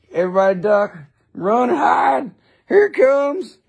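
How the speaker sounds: rising high cry of alarm; mock panic. Warning everybody as trouble arrives, high mock panic. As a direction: warn everyone in hammed-up panic.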